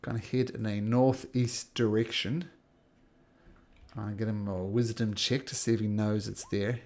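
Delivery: usual speaking voice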